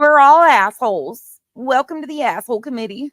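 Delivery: mock proud declaration. Mocking the others' insult.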